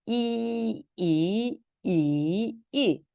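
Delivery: 이 level, rising, dipping, then falling; four-tone drill. Shown as a demonstration. Teacher letting students hear four tones on 이, level, rising, dipping, falling. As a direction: chant 이 through high level, rise, dip, drop. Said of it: "성조 사 성을 붙여서 한번 읽어볼게요".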